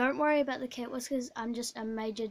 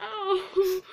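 laughing; 7 semitones higher, 5 dB louder than usual. Real, not acted. Laughing with mouth stuffed.

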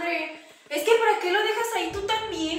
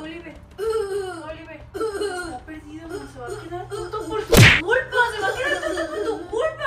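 frantic, tearful pleading that breaks into louder sobs; crying mother blaming her husband; panicked crying; 6 dB louder than usual. Real, not acted. Mother panicking over drugged child.

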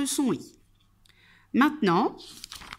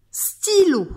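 "stylo" higher and louder, clearly set apart; word for repeating; 9 semitones higher, 6 dB louder than usual. Shown as a demonstration. Teacher giving the third word, "stylo" clearly modelled. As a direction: announce "stylo" high and loud for repeating. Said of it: "Troisième mot".

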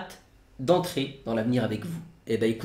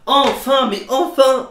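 overjoyed; 11 semitones higher, 7 dB louder, slower than usual. Real, not acted. Overjoyed repeated "enfin.

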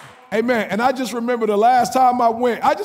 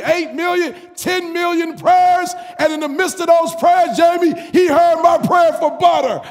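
higher, louder, building shouts; full-force preaching; 8 semitones higher, 4 dB louder than usual. Real, not acted. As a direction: preach it to the rafters.

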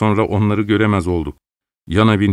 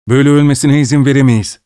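high, loud and fast; urgent; 6 semitones higher, 5 dB louder, faster than usual. A narrator's reading. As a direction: insist they must act now. Urgent plea as man hangs. (aceleyle, yüksek sesle ısrar)

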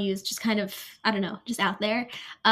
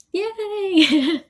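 high, loud burst of joy; excited cheer; 9 semitones higher, 7 dB louder than usual. Real, not acted. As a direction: cheer as the news lands. Opening college acceptance letter.

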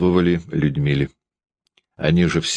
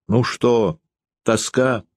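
higher, vexed jab; irritated; 6 semitones higher, slower than usual. Acted. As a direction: toss it out in annoyance. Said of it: "досадливо сказала Дарья".

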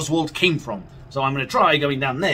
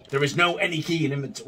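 normal talking voice

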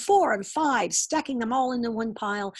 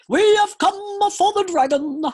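high, loud royal proclamation; commanding king; 12 semitones higher, 10 dB louder than usual. A narrator's reading. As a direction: announce it like a grand king. King speaking in story.

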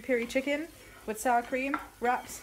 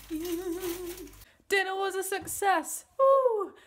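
1 delighted cheer; 10 semitones higher, much slower than usual. Real, not acted. Celebrating dinner on camera.

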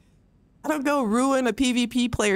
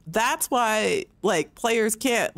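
ordinary talk, nothing marked

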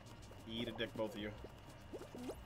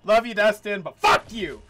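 loud, raised-pitch parting shot; exasperated yell; 6 semitones higher, 11 dB louder than usual. Real, not acted. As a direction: shout it at the friend before quitting. Parting yell at griefing friend.

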